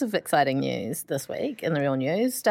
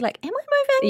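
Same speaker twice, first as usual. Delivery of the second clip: confused voice. Pretend. Acting out the sinking chair.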